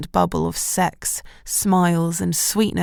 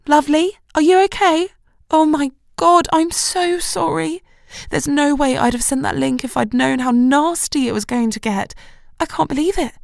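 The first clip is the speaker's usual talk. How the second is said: higher, concerned and apologetic friend; worried friend; 7 semitones higher than usual. A narrator's reading. Shelley on the phone to Jess, worried and sorry. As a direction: rush in with anxious sympathy.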